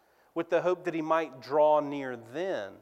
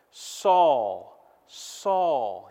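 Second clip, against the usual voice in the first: the Lord calling; 3 semitones lower, 4 dB louder than usual. Acted. Preacher voicing the Lord calling to Saul, solemn call. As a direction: voice of the Lord calling out a name, a little louder. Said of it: "he says".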